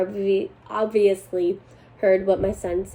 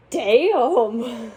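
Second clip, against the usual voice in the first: drawn-out, higher-pitched reaction of disbelief; amazed exclamation; 4 semitones higher than usual. Real, not acted. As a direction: stretch it out, swooping in pitch, astonished. Reacts to the harsh punishment read out.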